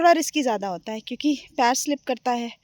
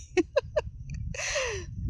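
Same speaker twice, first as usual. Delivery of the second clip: high giggle; 12 semitones higher, 8 dB quieter than usual. Real, not acted. Laughing at warning.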